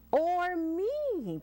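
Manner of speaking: high, boastful butterfly; bragging butterfly. Acted. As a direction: show off the claim. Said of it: "bragged the butterfly".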